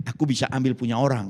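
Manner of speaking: ordinary delivery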